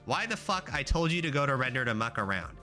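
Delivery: usual speaking voice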